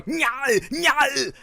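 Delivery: high, strained "nyah" wail; comic impression of Arnold screaming his head off; drawn-out high-pitched choking cries; imitated Arnold screaming. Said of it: "it's just Arnold screaming his head off".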